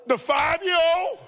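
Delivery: gasp, then high, loud incredulous question; shocked disbelief. Retold or imitated. Father hears his small son cussed, mock shocked. Acted shock at child cussing.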